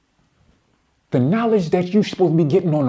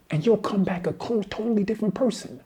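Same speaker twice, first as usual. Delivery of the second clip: ordinary talk, nothing marked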